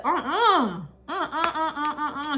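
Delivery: high, loud, laughing uh-uh; sassy refusal. Retold or imitated. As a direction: wag a finger with each uh-uh. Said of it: "And Sarah said".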